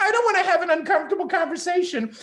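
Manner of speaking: high, fast whiny voice; mock timid man. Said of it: "the fucking men who are like".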